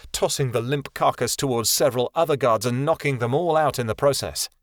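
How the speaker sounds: ordinary delivery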